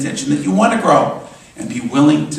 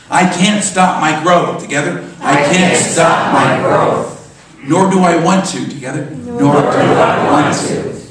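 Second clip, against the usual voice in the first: loud, slow, emphatic rallying call; rallying affirmation; 4 semitones higher, 6 dB louder than usual. Real, not acted. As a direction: lead the crowd in a slow, forceful chant. Leads congregation in chanted affirmation.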